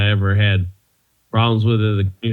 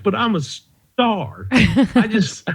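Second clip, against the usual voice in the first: slow, higher, hammed-up whine of a self-important star; mock diva protest; 8 semitones higher, slower than usual. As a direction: play the wounded star, half joking.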